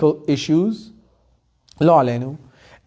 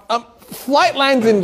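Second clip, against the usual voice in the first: ordinary talk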